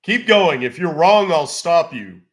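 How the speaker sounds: loud, high, impatient. Real, not acted. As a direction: snap at him to hurry. Impatient with slow scammer.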